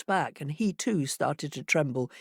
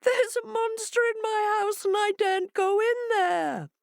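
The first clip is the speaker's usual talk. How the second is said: much higher, timid hare voice; small scared animal pleading; frightened hare. A narrator's reading.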